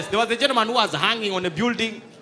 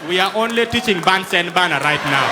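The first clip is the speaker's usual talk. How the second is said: loud teacher declaration; 2 semitones lower, 8 dB louder than usual. Retold or imitated.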